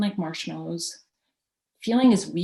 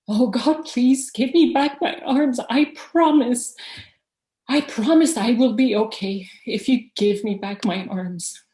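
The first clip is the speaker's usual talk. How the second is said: louder and higher, begging God; desperate pleading; 5 semitones higher, 5 dB louder than usual. Retold or imitated. Desperate prayer for his arms.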